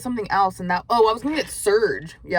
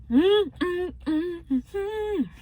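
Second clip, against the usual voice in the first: high delighted mm-mm hums; 11 semitones higher, much slower than usual. Real, not acted. Tasting food, delighted humming.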